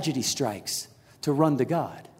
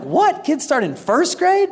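high-pitched, astonished yelp of a question; incredulous disbelief; 10 semitones higher, 4 dB louder, slower than usual. Retold or imitated. Dad learning rival kids started earlier, mock incredulous. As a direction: shoot way up in mock shock. Retold shock at other teams.

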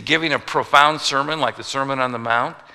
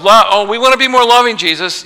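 higher, louder, fast mock pledge; mock eager promise; 8 semitones higher, 9 dB louder than usual. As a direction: overeager disciples, hammed up. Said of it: "they don't respond to this love".

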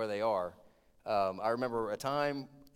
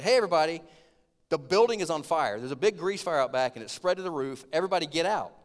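calling out warning; 4 semitones higher, 8 dB louder than usual. Retold or imitated. Warning diners building on fire.

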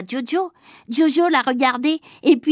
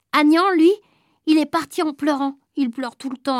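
plain reading voice

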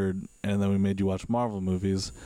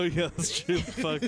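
ordinary talk

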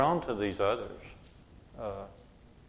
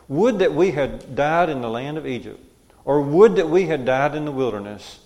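louder, forceful reading of the people's complaint; emphatic scripture reading; 3 semitones higher, 7 dB louder than usual. Acted. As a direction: read it out loud and insistent, as a protest. Reads scripture aloud with force.

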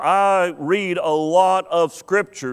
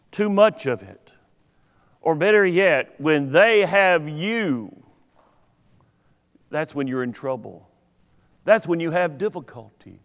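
usual speaking voice

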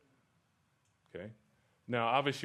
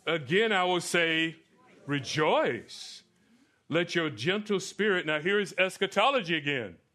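loud, raised scripture proclamation; emphatic preaching; 5 semitones higher, 6 dB louder than usual. Acted. Preacher expounding Philippians four to the congregation, emphatic preaching. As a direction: preach the verse out with strong projected stresses.

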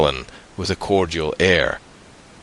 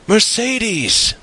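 high, happy call from outside; elated high-pitched calling; joyous call; 3 dB louder than usual. A narrator's reading. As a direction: sing out the name joyfully, pitched way up. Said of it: "called a joyous voice from without".

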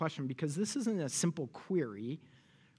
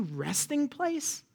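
mocking disbelief; 9 semitones higher, much slower than usual. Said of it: "You can hear it, can't you?".

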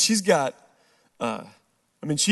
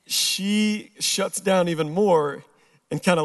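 ordinary talk, nothing marked

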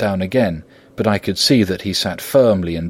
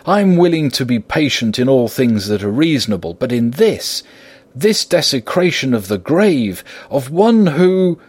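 indignant protest climbing higher and louder; choked with indignation; 6 semitones higher, 3 dB louder than usual. A narrator's reading. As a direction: build in outrage until the words choke off. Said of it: "He fairly choked with indignation".

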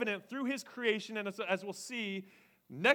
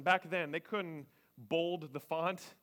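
usual speaking voice

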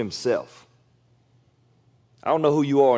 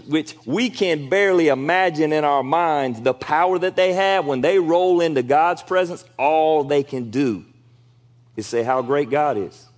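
ordinary talk

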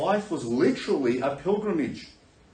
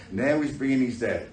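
usual speaking voice

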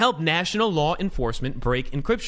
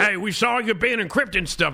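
cop voice; 5 semitones higher than usual. Pretend. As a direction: bark it like a tough cop. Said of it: "that's a cop's voice".

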